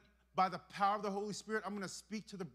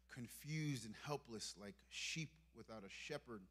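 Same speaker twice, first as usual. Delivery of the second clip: ordinary delivery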